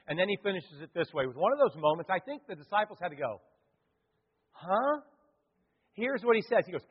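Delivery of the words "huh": puzzled huh. Retold or imitated. Disciples baffled.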